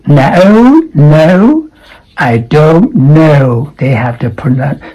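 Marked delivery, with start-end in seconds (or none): 0.1-1.7 no with a long oʊ. Shown as a demonstration. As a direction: hold the O of no. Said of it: "That is O".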